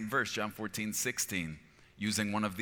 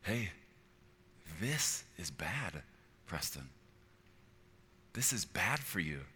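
slow, soft loving caution; gentle conviction; 4 dB quieter than usual. Acted. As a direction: warn them tenderly, without attacking. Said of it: "I believe with this tone".

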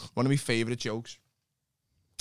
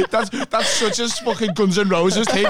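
laughing; 8 semitones higher, 7 dB louder than usual. Real, not acted. Laughing at a rock story.

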